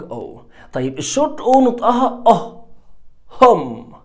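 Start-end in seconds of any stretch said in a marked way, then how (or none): 0.0-0.4 long O said very softly; soft trailing long o. Shown as a demonstration. Teacher finishing the rule quietly. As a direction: let او fade out low. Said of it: "للونج".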